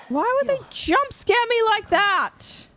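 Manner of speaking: high-pitched indignant yelp of protest; startled outburst. Real, not acted. Player protesting a jump scare, startled and high-pitched. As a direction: cry out in shocked complaint.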